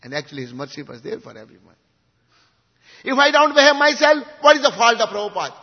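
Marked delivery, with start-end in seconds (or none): 3.0-5.6 raised, high-pitched loud questioning; impassioned challenge. Real, not acted. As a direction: demand it rhetorically of the listeners. Rhetorical outburst of self-blame.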